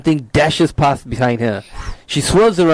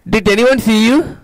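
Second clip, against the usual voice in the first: put-on Indian accent; 8 semitones higher than usual. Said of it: "Indian accent".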